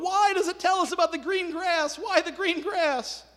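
high, loud mock-frustrated outcry; hammed-up exasperation, stretching the last words; exasperated bafflement. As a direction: wail it like banging your head on a wall. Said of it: "banging their heads against the wall".

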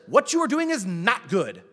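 loud, emphatic imitated reprimand; stern rebuke. Father-in-law tells Moses he is wrong, sternly. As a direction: quote a firm telling-off, hitting it hard.

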